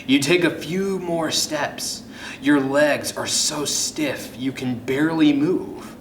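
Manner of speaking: ordinary delivery, nothing marked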